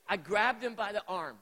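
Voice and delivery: ordinary talk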